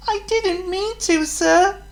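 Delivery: very high, meek apology. Acted. Thomas meekly answering the Fat Controller. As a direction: explain it meekly. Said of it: "Thomas explained meekly".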